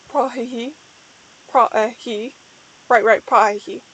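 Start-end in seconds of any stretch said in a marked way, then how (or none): none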